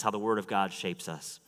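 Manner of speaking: usual speaking voice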